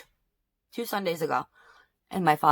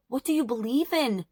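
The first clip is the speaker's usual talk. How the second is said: ordinary talk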